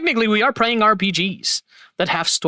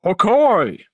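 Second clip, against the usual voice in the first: loud character shout; 4 dB louder than usual. Shown as a demonstration. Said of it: "Or it's like".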